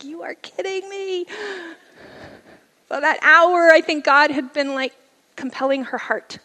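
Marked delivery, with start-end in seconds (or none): 0.0-1.3 high, whispered disbelief; stunned whisper. Retold or imitated. Hearing the aunt's news, whispered disbelief. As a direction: gasp it quietly.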